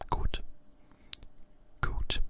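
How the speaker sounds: "Gut" with a long U and a crisp T at the end; German gut. Shown as a demonstration. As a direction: say "Gut" like good, but with a hard T. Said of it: "a lot like good, but with a U, with a T".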